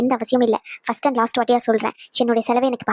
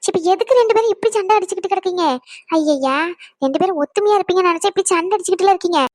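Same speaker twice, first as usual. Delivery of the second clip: scolding mother-in-law; 6 semitones higher than usual. Acted. Mother-in-law scolding son and daughter-in-law, higher exasperated voice. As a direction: scold the two of them like a fed-up mother-in-law.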